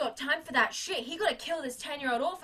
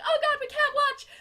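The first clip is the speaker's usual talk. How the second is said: horrified; 11 semitones higher, 6 dB louder than usual. Retold or imitated. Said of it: "They're like".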